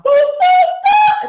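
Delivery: high, loud big-sounding boops; big open boop sung high; big booming boop. Shown as a demonstration. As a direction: sing a big, full boop. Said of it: "It's not going boop".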